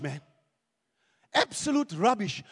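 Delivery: usual speaking voice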